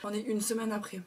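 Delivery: just woke up, voice still rough with fry; morning voice. Real, not acted. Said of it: "j'ai ma voix du matin".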